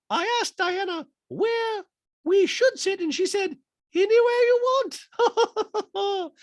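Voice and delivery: high, loud falsetto; hammed-up housewife storytelling; Dorit impression voice.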